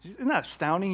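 ordinary talk, nothing marked

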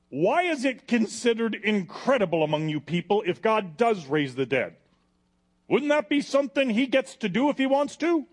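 Paul's loud, rising challenge to Agrippa; bold challenge. Acted. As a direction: fling the question at the king. Paul challenges King Agrippa.

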